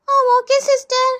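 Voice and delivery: high, slow schoolkid voice; drawn-out little-kid reply; child pupil. Acted. Pupil telling nurse it doesn't hurt, slow child voice.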